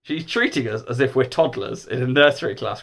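plain reading voice

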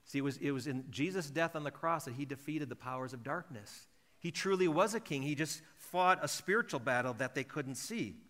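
ordinary talk

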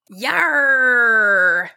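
loud monster Yarr; monster exclaiming. Acted. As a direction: bellow it like a beaten monster. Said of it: "exclaimed the monster".

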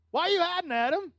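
high, loud amused question; God questioning Adam. Retold or imitated. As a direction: ask like a father who already knows. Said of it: "What did he do?".

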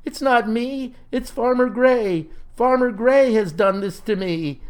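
high, protesting plea; desperate cry. A narrator's reading. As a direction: plead innocence to a lost sweetheart. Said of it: "cried he".

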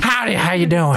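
a hint of an old frontier prospector; folksy old miner greeting. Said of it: "He looks exactly like you would expect an old prospector".

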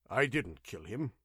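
ordinary delivery

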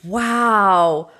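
long, drawn-out wow; amazed reaction. Real, not acted. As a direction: let out a real, awed wow. Listener reacts to the story's climax.

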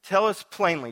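high, demanding "tell us"; impatient crowd. Retold or imitated. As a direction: press him for a straight answer. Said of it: "it's like".

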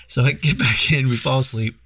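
ordinary talk

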